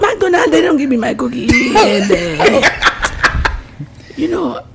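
high-pitched put-on Haitian accent; mock Haitian accent. Acting the cookie woman for friends, mock Haitian accent. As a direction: play an aggrieved customer in a Haitian accent. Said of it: "do a Haitian accent".

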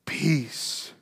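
soft whisper of peace; a whispered benediction; whispered blessing. Acted. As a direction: whisper it like a comfort. Jesus greeting the traumatized.

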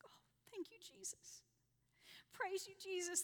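hushed, breathless whisper of thanks; awed whisper. Retold or imitated. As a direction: whisper it as if you barely dare believe it. Second step held, awed thanks.

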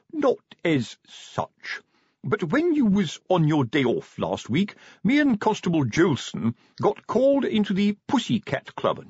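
high, roundabout sergeant's explanation; delicate sergeant. Acted. Sergeant explaining club call-out to corporal, delicately. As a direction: pick your way through an embarrassing report.

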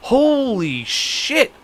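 very slow, high, drawn-out disbelief; stunned holy shit. Retold or imitated. Driving away after the weird encounter, drawn-out stunned disbelief. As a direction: let it stretch out in shock. Shocked leaving the store.

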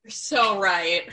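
slow, dreamy "so right"; fawning agreement. Pretend. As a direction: agree adoringly, drawn out. Mock agreeing podcast banter.

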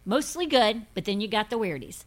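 ordinary talk

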